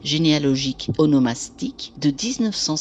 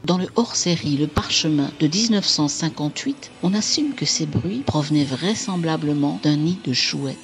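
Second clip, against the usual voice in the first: ordinary delivery, nothing marked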